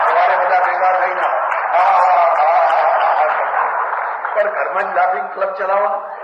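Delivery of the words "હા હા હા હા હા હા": loud forced ha-ha laughter; laughing club laughter. Retold or imitated. Preacher mocking a laughing club's forced laugh. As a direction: laugh on cue, hammed-up and hollow. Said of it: "laughing ક્લબો ખોલવી પડી".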